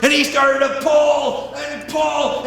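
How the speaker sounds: ordinary delivery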